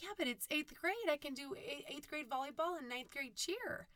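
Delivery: whiny protest. Retold or imitated. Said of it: "I'm like".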